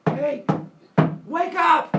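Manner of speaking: very high, loud yell; shouting wake up. Acted. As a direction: yell her awake. Apollo wakes Maia.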